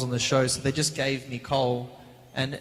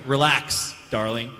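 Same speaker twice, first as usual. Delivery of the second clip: American accent; 3 semitones lower, slower than usual. Shown as a demonstration. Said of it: "imagine if Cole was American".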